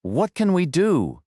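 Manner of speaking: high, slow, frightened question; scared plea. A narrator's reading. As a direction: plead for a way out, afraid.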